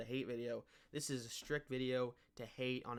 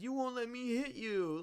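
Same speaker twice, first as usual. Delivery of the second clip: high, whiny mocking voice; mock crying whine; 11 semitones higher, 4 dB louder than usual. Mocking the fighter's complaint, high and whiny. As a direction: whine it like a sore loser. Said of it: "he's crying because".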